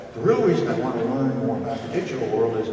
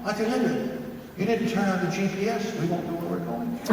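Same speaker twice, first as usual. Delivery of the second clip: put-on child explaining; 9 semitones higher than usual. Child voiced in a story.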